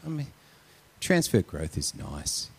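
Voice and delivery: ordinary talk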